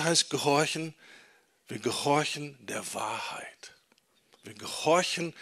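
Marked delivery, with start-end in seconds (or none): 1.7-3.7 whispered. Shown as a demonstration. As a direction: whisper it, almost no voice. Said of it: "repeats key sentence twice". (geflüstert, fast ohne Stimme)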